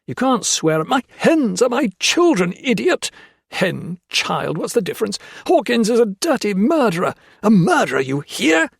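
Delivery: high, loud raving; ranting. A narrator's reading. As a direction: rave at him like a lunatic. Foster ranting about his hen.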